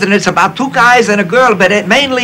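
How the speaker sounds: high mock Austrian-German accent; Billy Wilder's accent. Lemmon imitating Wilder's Austrian-German accent pitching the film plot. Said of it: "Katzenjammer Kids accent".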